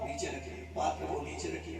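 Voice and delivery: quick unvoiced cue to the devotee; whispered aside. Real, not acted. Priest softly telling the devotee to put the vessel down, whispered. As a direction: whisper the instruction under the chanting. Quiet aside instruction during ritual.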